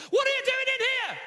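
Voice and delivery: high, loud character voice; supposed whisper, blared. Acted. Dad reading Harry Potter to kids, wrongly loud. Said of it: "he whispered".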